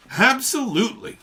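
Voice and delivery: very high, loud burst; sudden exclamation. A narrator's reading. Miss Baker breaks her silence, sudden. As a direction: blurt it out of nowhere. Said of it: "with such suddenness that I started".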